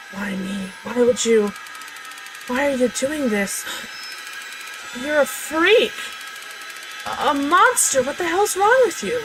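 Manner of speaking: frightened pleading to shouting. Acted. Voicing abusive parents' flashback.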